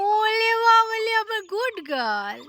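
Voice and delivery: slow, drawn-out doting coo, high then falling. Real, not acted. Parent praising the toddler as good girl, cooing baby talk. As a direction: coo proudly over a little one, stretching the words.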